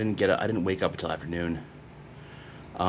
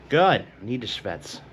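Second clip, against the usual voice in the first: normal talking voice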